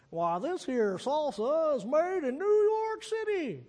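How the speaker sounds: high, drawn-out southern hillbilly drawl. Retold or imitated. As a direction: imitate a slow hillbilly from an old TV commercial. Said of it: "southern hillbilly voice".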